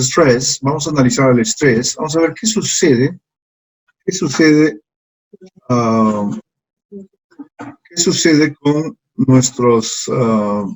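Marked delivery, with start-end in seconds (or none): 2.0-10.7 sucede drawn out very slowly; slow, stretched repeat. Shown as a demonstration.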